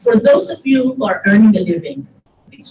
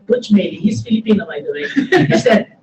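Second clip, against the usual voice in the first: usual speaking voice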